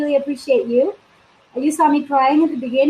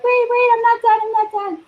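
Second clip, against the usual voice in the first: high, loud flustered wait-wait; playfully fending off eager joiners; mock protest; 9 semitones higher, 4 dB louder than usual. Coach on a live stream mock-protesting eager joiners, high and loud. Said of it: "and I'm like".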